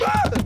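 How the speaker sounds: sudden high cartoon yelp; mock scream. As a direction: yelp as if cut off mid-introduction, hammed up. Toy cut off in skit.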